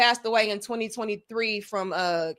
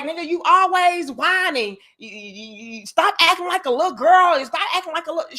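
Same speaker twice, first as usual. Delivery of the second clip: mother yelling; 5 semitones higher than usual. Retold or imitated. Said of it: "But she was like".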